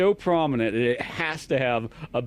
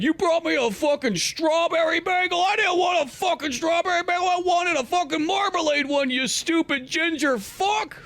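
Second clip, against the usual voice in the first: furious boss yelling. Retold or imitated. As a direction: scream it like an enraged editor, slowing on the last insult. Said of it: "literally fucking tearing a new asshole into Jimmy Olsen".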